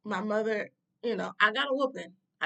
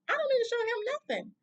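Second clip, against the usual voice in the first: much higher-pitched, indignant refusal; defiant outburst; faster than usual. Retold or imitated. Granddaughter told to respect a stranger, defiant. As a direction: push the voice way up in retold defiance.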